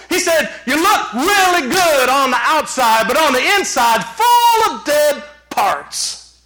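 high, forceful sermon delivery; impassioned preaching. Real, not acted. Pastor warning congregation against surface religion, preaching high and forcefully. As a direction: preach it out with full conviction.

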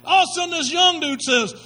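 usual speaking voice

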